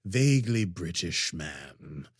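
slow, creepy, vaguely British villain; creepy James Mason. Shown as a demonstration. Host slips into his James Mason villain, slow. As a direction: purr it like a schemer with motives. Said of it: "your James Mason is a bit like Jafar".

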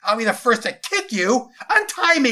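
put-on high, loud boy's threat; furious captive boy shouting; angry yelling. A narrator's reading. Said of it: "yelled Jason at the man".

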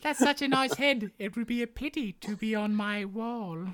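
prim gentle lady; hammed-up delicate Sita; dainty mock Sita. Correcting, voicing Sita.

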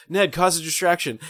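quick-witted hero's urgent cue; urgent mock order. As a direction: call out a hurried order, acted. Peter's urgent order acted out.

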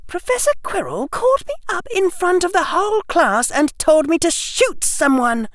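put-on very high girl's voice, aggrieved; indignant schoolgirl. Hermione complains to Harry about the professor, indignant and high. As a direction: protest in a high-pitched, indignant voice.

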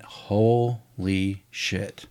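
very slow, hushed shock; stunned. Retold or imitated. Filmmaker hearing the company had closed, slowly stunned.

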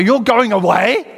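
loud, high incredulous question; panicked disbelief. Acted. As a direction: echo it back aghast, rising at the end. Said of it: "I wanted you to kind of feel the panic".

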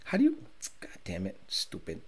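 whispered curse under the breath; hushed annoyed swearing at the phone; quietly fuming, barely voiced; frustrated whisper. Real, not acted. Struggling with video playback.

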